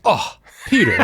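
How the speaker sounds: jaw-dropped at a friend's blunder; shocked gasp. Retold or imitated. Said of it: "my jaw went agape like".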